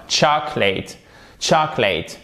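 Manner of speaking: "chocolate" as CHAH-klit, two syllables; "chocolate" stressed first, falling at the end; silent middle vowel. Shown as a demonstration. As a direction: drop the second o of "chocolate". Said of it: "on ne la prononce pas".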